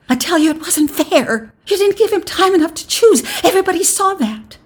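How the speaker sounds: very high, loud insistence; desperate protest. A narrator's reading. Wife insists to the crowd, high desperate protest. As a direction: plead that you were cheated.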